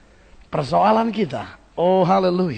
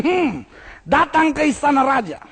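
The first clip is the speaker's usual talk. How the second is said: usual speaking voice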